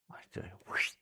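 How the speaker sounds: hushed teasing threat; whispered play-fight taunt to the puppy; playful whisper. Pretend.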